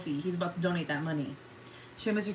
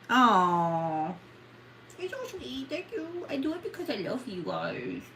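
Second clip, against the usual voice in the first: tender thanks; 3 semitones higher than usual. Real, not acted. Thanking donor.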